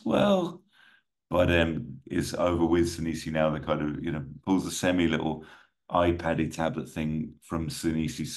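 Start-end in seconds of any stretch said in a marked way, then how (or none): none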